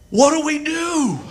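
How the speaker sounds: hammed-up bewilderment; exasperated plea. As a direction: wail it helplessly, played for laughs. Stranded, exasperated retold question.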